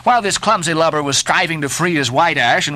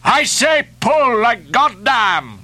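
high, slow, emphatic cry; harpooner's forceful high-pitched shout; fierce cry; 8 semitones higher, slower than usual. A narrator's reading. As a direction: answer the challenge with a drawn-out cry. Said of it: "cried the Indian".

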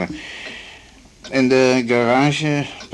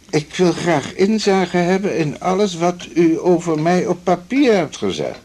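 mock demanding woman, higher and louder; imitating the patient; 9 semitones higher, 5 dB louder than usual. Doctor mimics the feminist patient demanding her records. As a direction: put on her indignant voice. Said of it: "Ze zegt".

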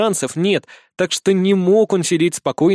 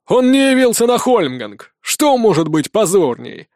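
indignant shout, higher; 3 semitones higher than usual. A narrator's reading. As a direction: yell up at the wall guards. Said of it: "крикнул Рокон".

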